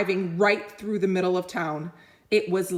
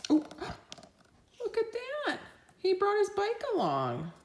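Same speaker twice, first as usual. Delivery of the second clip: excited gasp; 14 semitones higher, 7 dB quieter, faster than usual. Real, not acted. Reader marvels at picture aside.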